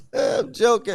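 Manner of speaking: squeaky laugh through the words; laughing hard while insisting it's a joke; high giggling I'm joking. Real, not acted.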